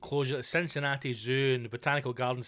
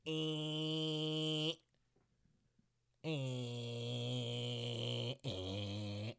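low, much softer buzzing drone; imitated engine buzz; 4 semitones lower, 12 dB quieter, much slower than usual. Walker hearing a far-off strimmer, buzzing sound. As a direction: buzz like a tiny distant motor. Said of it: "and you hear".

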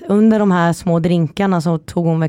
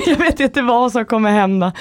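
higher, laughing through the words; laughing quote; 5 semitones higher than usual. Retold or imitated. Friend dreading a trip with the speaker, retold laughingly. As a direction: quote a friend while giggling.

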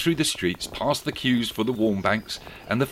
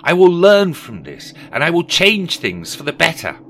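higher, louder resolute vow; Rishi Scrooge voice; 9 semitones higher, 9 dB louder than usual. Acted. Scrooge vowing to himself, higher and louder. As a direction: Scrooge swearing to reform.

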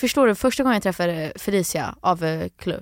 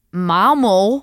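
loud Malmö pronunciation, "mamo"; Malmö accent demo; 4 dB louder than usual. Shown as a demonstration. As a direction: say Malmö as "mamo", swallowing the consonants. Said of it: "att man inte säger sina R". (överdriven malmöitisk dialekt)

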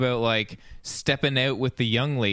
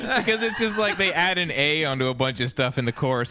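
usual speaking voice

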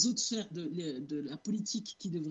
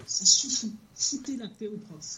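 angry; 3 semitones higher, 7 dB louder than usual. Real, not acted. Exasperated teacher ends her rant.

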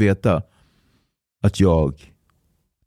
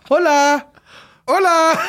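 calling out; slower than usual. Retold or imitated. Pirates call out greeting.

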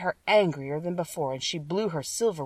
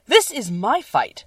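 slow, higher and louder Woodman; resolute Tin Woodman; 5 semitones higher, 6 dB louder, slower than usual. A narrator's reading. Tin Woodman protecting his friends, slow and firm. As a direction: plant your feet and claim the fight.

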